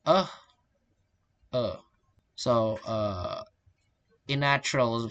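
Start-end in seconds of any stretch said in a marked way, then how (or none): 0.1-1.8 "uh" as a short neutral schwa; "uh" said as an isolated weak vowel; reduced vowel. Shown as a demonstration. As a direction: demonstrate "uh", the unstressed central vowel. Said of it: "vowel reduction, unstressed vowel become".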